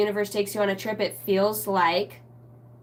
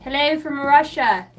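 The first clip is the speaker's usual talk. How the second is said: put-on higher, louder Russian accent; 6 semitones higher, 5 dB louder than usual. Greeting chat in a Russian accent. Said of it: "when I try to do it".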